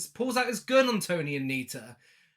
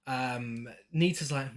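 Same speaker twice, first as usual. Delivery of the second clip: ordinary talk, nothing marked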